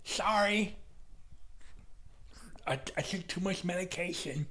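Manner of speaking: mock meek manager; sheepish apology. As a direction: apologize meekly like a drugged manager. Imitating Ernie apologizing.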